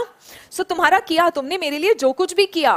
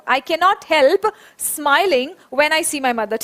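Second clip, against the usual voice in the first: very slow, word by word, a pause after help; slow dictation; much slower than usual. Shown as a demonstration. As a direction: spell it out slowly for the class. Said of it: "एक बार मेरे साथ में ये बोलेंगे". (बहुत धीरे, रुक-रुक कर)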